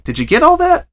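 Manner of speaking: high, loud out-of-breath question; breathless cheerful pony; panting. A narrator's reading. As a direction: puff after a long rambling story. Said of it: "Pinkie panted".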